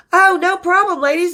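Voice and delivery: high-pitched imitation of a Southern singer; Southern drawl. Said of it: "with their southern draws, drawls".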